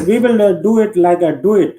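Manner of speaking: ordinary talk, nothing marked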